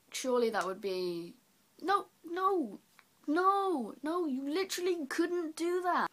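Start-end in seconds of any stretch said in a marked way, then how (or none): 1.8-6.1 high-pitched, drawn-out no; high, exasperated protest; emphatic refusal. Real, not acted. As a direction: reject the idea in a high, incredulous pitch. Emphatically rejecting his own idea.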